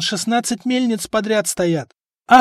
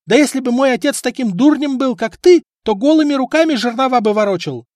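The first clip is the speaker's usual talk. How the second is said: high, much louder shrill wife's yell; 10 semitones higher, 7 dB louder than usual. A narrator's reading. Wife yelling at husband and apprentices, loud. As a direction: scream at the lazy crew. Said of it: "продолжала орать жена".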